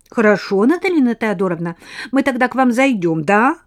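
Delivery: put-on high, bright, rapid girl's chatter; chatty little girl. Lena answers.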